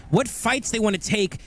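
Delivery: ordinary delivery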